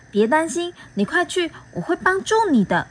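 lightly voiced kind bee; kind comfort. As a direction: soothe him gently. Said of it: "好心的安慰我".

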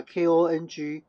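spelling it out. Shown as a demonstration. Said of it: "键盘打".

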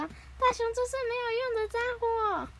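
echoed high cartoon put-down; mock jeer. Nobita is made to echo the insult, mock jeer.

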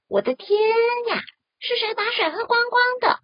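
尖声惊叫，又快又高; 吓坏了似的高声喊出来. Acted. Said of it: "跳蚤大叫一声". (高声大叫)

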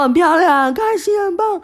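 very high, wailing through tears; sobbing answer. Retold or imitated. She answers the coach while sobbing, very high wail. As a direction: squeak out the words while crying. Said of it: "我都说".

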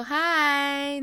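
high, loud hi; exuberant greeting. Acted. Greeting a colleague at the restroom, exuberant. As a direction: sing out an exaggerated hello.

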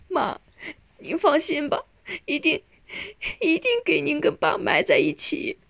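high, tearful promise; crying. A narrator's reading. Daughter promising dying mother her burial wish, crying. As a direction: promise through sobs, in broken phrases. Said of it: "少英哭着说".

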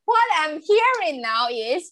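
high, laughing voice breaking into the words; laughing through words. Real, not acted. Laughing while quoting the bookstore novel's communication advice. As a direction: giggle your way through it.

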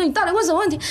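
high, pressing question with stress on 问; anxious demand. Retold or imitated. Friends asking the fourth player again, high and rattled. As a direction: demand an answer, still rattled.

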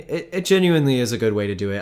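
loud, fast, eager sales pitch. Pretend. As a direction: sell it hard like a recruiter. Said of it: "doing my isaac pitch".

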